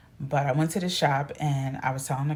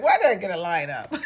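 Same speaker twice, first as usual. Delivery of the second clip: fast, laughing through the words; amused at your own new lineup; laughing question; 2 semitones higher, 6 dB louder, faster than usual. Retold or imitated. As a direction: giggly question.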